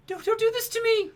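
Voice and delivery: high, loud hammed-up wail; mock-distraught high cry; mock pleading. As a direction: plead in a squeaky put-on voice. Retold emotional plea watching broadcast.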